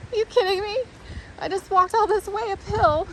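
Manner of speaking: high-pitched mock disbelief; exasperated. Retelling finding the trail closed after the uphill climb, high exasperated disbelief. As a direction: whine it up high, fed up.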